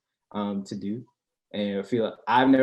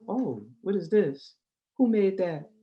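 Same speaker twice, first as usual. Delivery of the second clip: high, slow surprised woman's voice; mock delighted date; imitating her; 9 semitones higher, slower than usual. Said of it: "she's gonna be like".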